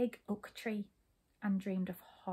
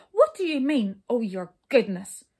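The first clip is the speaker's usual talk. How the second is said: loud, indignant demand; roaring temper; 9 dB louder than usual. A narrator's reading. King challenging the brownies' words, loud temper. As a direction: demand an answer in a fury. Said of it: "roared the king in a temper".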